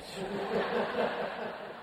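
quiet unvoiced chuckle; breathy laugh. Real, not acted. Writer chuckling to audience about mother's fading pride, soft laugh. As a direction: laugh softly at the irony.

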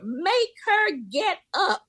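high, slow, whiny demand. Retold or imitated. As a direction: whine it at the driver. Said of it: "they're telling the bus driver".

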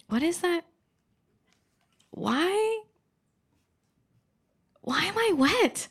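high, rising bewildered questions; puzzled discovery. Retold or imitated. Discovers wetness during yoga.

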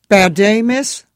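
high, loud Eastern European cabbie voice; thick Moldovan accent, chatty and friendly. A narrator's reading. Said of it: "This one had an accent".

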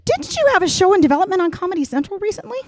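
high, fast chirpy receptionist; perky high office voice. Retold or imitated. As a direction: gush brightly at the caller. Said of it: "and I go".

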